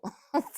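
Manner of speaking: short high burst of laughter; quick embarrassed giggle. Real, not acted. Embarrassed about restroom detail.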